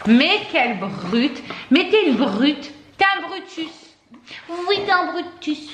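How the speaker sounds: mock-scold the dog like a baby; playful pet talk. Mother teasing her rough dog in a slow, high, playful pet voice.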